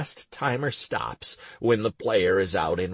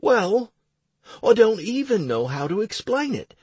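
put-on higher, lively young priest; young man voice; 12 semitones higher, 4 dB louder than usual. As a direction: voice Clowder up and eager. Clowder continues.

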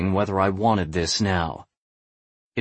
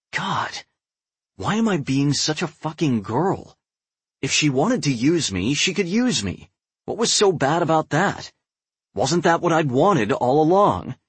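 higher, frustrated self-questioning; exasperated; 7 semitones higher than usual. A narrator's reading. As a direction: berate yourself, exasperated. Frustrated inner monologue.

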